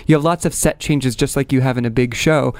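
ordinary talk, nothing marked

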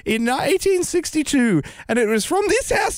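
high, slow royal Christmas address; Queen voice. Acted. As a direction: read it as the Queen, stately. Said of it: "in a Queen voice? I'll do the voice".

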